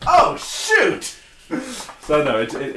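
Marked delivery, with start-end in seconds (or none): 0.1-1.2 sudden high mock yelp of dismay. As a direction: jump up high, playfully alarmed. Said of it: "And then I'd be like".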